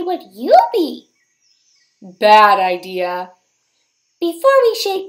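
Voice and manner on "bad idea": bad idea.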